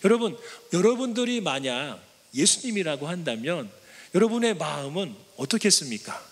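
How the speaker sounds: ordinary delivery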